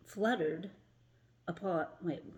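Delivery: ordinary delivery, nothing marked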